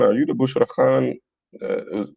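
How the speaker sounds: ordinary delivery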